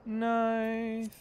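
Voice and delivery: high, stretched nice; high drawn-out nice. Acted. Repeated nice, high long.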